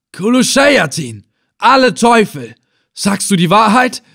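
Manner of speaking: high, loud exclamation of alarm; shocked cursing. Acted. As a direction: curse aloud, then demand the truth. Villain curses at shocking news.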